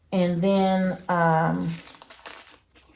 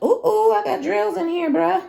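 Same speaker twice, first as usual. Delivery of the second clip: put-on high, excited teen bragging; a lightly voiced bragging teen, much higher; mock teen hype; 12 semitones higher than usual. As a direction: hype up like a kid showing off. Imitating the teen's posts.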